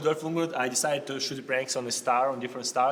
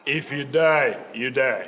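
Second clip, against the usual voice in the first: Drago voice; slower than usual. Acted. Drago quote reply.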